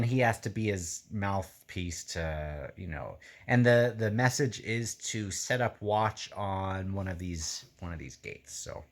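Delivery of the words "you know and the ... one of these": ordinary delivery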